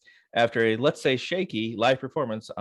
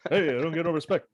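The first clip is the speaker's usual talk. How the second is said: pitched-up Rodney Dangerfield catchphrase; Rodney Dangerfield impression; 6 semitones higher, faster than usual. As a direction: deliver the catchphrase as the old comic, higher. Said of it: "That's a good impression".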